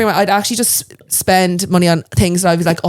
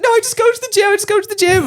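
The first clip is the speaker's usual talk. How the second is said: flustered retort; 13 semitones higher than usual. Retold or imitated. Retold flustered answer to the doctor.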